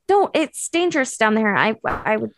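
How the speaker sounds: usual speaking voice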